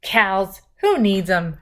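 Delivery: usual speaking voice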